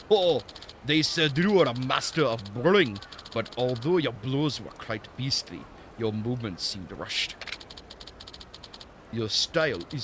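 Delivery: hammed-up mock-Japanese martial arts master; Temu Japanese accent. Old master judging a fighter's technique, bad Japanese accent. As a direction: critique in a badly faked Japanese accent. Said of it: "doing some Temu Japanese accent".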